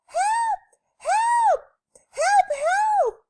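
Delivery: very high, loud cries for help; shrieked, separate bursts; yelling and screaming. A narrator's reading. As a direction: scream like a panicked storybook lady. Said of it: "lady who was yelling and screaming".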